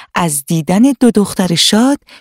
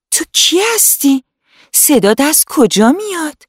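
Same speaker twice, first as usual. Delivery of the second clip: put-on much higher, wide-eyed little girl voice; astonished girl; 9 semitones higher than usual. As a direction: call out, baffled, to a hidden voice. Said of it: "با تعجب گفت".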